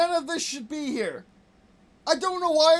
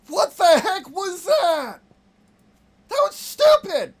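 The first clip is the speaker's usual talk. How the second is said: loud outburst climbing higher; frustrated yelling; 6 semitones higher, 7 dB louder than usual. Real, not acted. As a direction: yell it, rising into a high shout. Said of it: "Sorry that I'm yelling".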